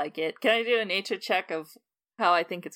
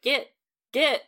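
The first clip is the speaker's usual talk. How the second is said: loud, raised-pitch shooing calls; shooing shout; 7 semitones higher, 9 dB louder, slower than usual. Acted. Player's character shoos off the monster, shouting. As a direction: shout it to chase an animal off. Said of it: "a kind of shooing motion".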